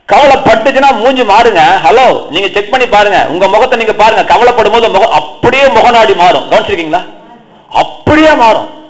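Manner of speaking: high, insistent preaching voice; emphatic preaching. Real, not acted. Preacher warning congregation that worry changes faces, high insistent preaching.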